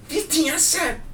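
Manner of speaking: very high squeaky rapper voice. Retold or imitated. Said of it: "Just like".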